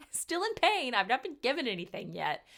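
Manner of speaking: high, loud comic complaint; exasperated protest. Retold or imitated. Patient giggling but still in pain, loud exasperated protest.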